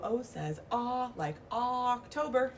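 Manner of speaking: "aw" drawn out for O, then "October" stretched; letter sound aw. Shown as a demonstration. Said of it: "it starts with an O".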